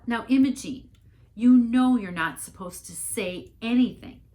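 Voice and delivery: loud, high scold; mother rushing up the aisle to scold Imogene, loud. A narrator's reading. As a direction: rush in and stop her, loudly.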